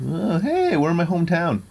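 high, loud burst of recognition; delighted surprise. Real, not acted. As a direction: exclaim happily at spotting your hometown. Delighted to recognise own hometown.

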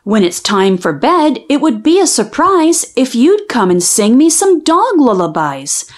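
ordinary delivery

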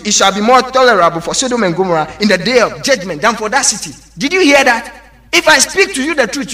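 ordinary delivery

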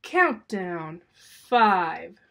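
loud countdown announcement in a lightly put-on computer voice; blaring computer voice. The contraption's speaker starts the countdown, lightly robotic and loud. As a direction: blare out the count like a machine. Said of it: "A scratchy computer voice blared".

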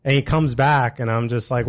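ordinary talk

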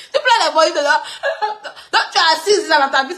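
high, laughing tease; real laughter breaking into the words. Real, not acted. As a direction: keep mocking them while giggling. Cracks up teasing complainers.

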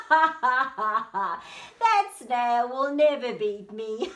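loud, cocky horse voice; gloating boast. Acted. Horse races off sure of winning, loud boast. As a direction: crow it at full volume.

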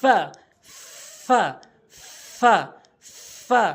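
long airy f, then short "ফা"; ফা demonstration. Shown as a demonstration. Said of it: "উপরের দাঁতগুলো নিচের ঠোঁটের পেট". ("ফা", উপরের দাঁত নিচের ঠোঁটের পেটে)

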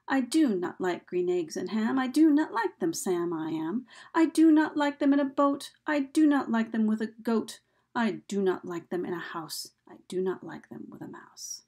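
chant-like beat on every second syllable, "do", "like"; iambic rhythm. Shown as a demonstration. Teacher reciting a Seuss example for students, marked iambic rhythm. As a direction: recite with "do" punched in each "I do not like", easing off by "mouse". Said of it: "we find this iambic rhythm everywhere".

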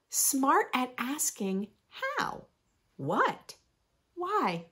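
lively, sing-song picture book reading; reading to children. Acted. Reading a rhyming book to young listeners, bright storytime voice. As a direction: read it like storytime for little ones.

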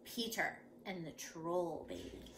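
soft, slow title reading; hushed title. Acted. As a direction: let the title hang in the air. Title read softly, slowly.

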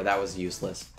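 normal talking voice

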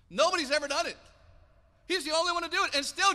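preacher's excited high shout; emphatic preaching. Real, not acted. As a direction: hammer it home from the pulpit. Preacher stresses Peter's unique feat.

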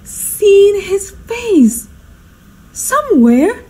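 high, drawn-out incredulous echo; dawning astonishment. Acted. Hostess echoing her butler as it dawns, astonished. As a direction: echo it slowly as the truth dawns. Hostess realises who the visitor is.

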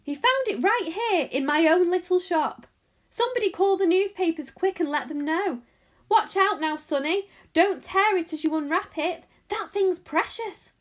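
high, loud, flustered shopkeeper; excited yelling. A narrator's reading. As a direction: holler urgent orders in great excitement. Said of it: "he yelled".

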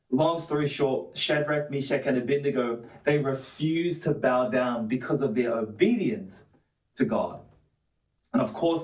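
usual speaking voice

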